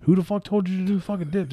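high, disbelieving put-on voice; mock outrage. Jericho demanding who told Benoit to do dips, mock outrage. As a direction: imitate a baffled, scolding coworker. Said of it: "He's like".

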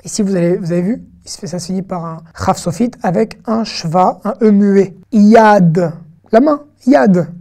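usual speaking voice

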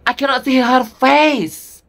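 high, loud, drawn-out fan complaint; exasperated outburst. Real, not acted. Fan telling viewers the singer's face is hidden, exasperated. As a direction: cry out in baffled frustration, stretching it slowly.

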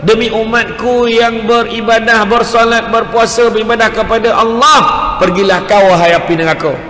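ringing, authoritative order; commanding proclamation. Retold or imitated. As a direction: proclaim it forcefully, like banishing a great danger. Acts Prophet commanding hellfire away.

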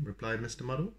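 plain reading voice

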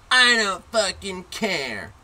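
slow, high, loud bratty holler; mock kid's drawn-out shout; imitated defiant yell. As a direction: bellow it back like a defiant kid. Imitates defiant kid yelling back.

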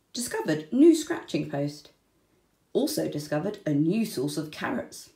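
ordinary delivery, nothing marked

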